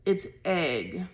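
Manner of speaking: grim and ominous. A narrator's reading. Mother discovering the prank, ominously. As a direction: pronounce it like a verdict. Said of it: "in an ominous voice she announced".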